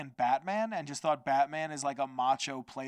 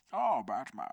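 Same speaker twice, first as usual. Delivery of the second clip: Bane voice. Retold or imitated. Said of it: "to do the Bane voice".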